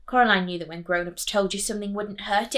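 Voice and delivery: usual speaking voice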